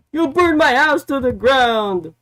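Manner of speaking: high, loud accusing shout as Hangman; mock angry yell. Hangman yelling at Swerve backstage, hammed-up shout. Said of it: "And then Hangman was like".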